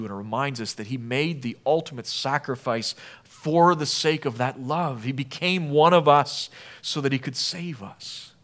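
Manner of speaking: ordinary delivery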